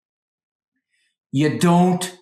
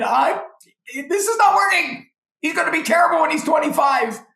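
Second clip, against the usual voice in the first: exasperated outburst. Retold or imitated. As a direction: blow up at the thought of the kid at twenty-five. Frustrated parent venting inner thoughts.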